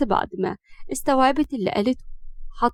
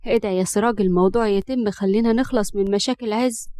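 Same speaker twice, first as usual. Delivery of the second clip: usual speaking voice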